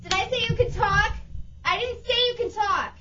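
harsh barking commands. Acted. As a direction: snap at her like a harsh mistress. Dominatrix scolding the girl.

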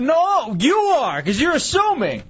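pushing the accusation back at the co-host; emphatic protest. Real, not acted. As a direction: cut him off and throw it back at him.

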